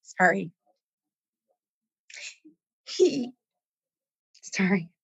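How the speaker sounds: breaking off, fighting back tears; real tears stop the words; choked up. Real, not acted. Guest recalling a dying client, choking up. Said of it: "this is where it gets me".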